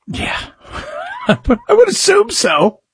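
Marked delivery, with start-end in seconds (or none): 0.7-1.2 a quick high disbelieving laugh; brief nervous laugh; short high laugh. Real, not acted. Nervous reaction to fatal ride.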